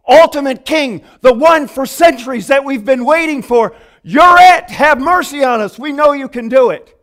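loud, high, eager calling; excited crowd. Retold or imitated. Blind men hailing Jesus, loud and excited. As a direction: hail the long-awaited king. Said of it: "they're saying".

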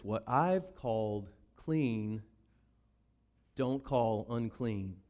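slow, low, solemn divine voice; voice of God. Acted. God correcting Peter, slow low solemn voice. Preacher voices God's reply.

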